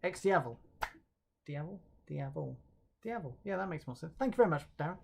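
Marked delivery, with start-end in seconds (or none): 0.0-0.6 "X Diavel" run together, dee-A-vel, falling; corrected pronunciation. Shown as a demonstration. Presenter repeats the viewer's correction, practised pronunciation. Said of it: "telling me it's pronounced X Diavel". 1.5-3.4 softly trying out "Diavel", dee-A-vel; "Diavel" first as a question, then settled; practising pronunciation. Shown as a demonstration. Presenter practises the corrected name, quiet pronunciation drill. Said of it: "telling me it's pronounced X Diavel".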